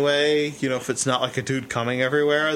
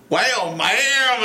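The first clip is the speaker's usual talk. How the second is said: higher, drawn-out mock Texas drawl; fake Texas accent; 8 semitones higher, 4 dB louder than usual. Cohost teasing host at craft shows, fake Texas accent. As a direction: imitate a hammy Texas twang. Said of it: "your fake Texas accent".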